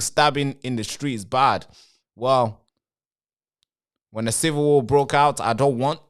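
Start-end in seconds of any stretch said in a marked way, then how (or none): none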